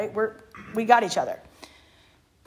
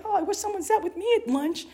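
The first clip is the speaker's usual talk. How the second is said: high, small, lonely voice; wistful little remark; 10 semitones higher, 4 dB quieter than usual. Retold or imitated. Woman hinting to the girls about lonely lunches, high and small. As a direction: drop it like a quiet hint of hurt. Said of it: "say a little something like".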